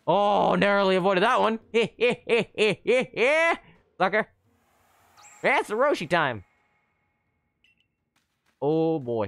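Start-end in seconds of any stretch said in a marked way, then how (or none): 1.6-3.6 high staccato cackle rising at the end; string of high, taunting laughs; gloating cackle. Real, not acted. Player just dodged an attack, cackling in triumph. Gloats after narrow escape.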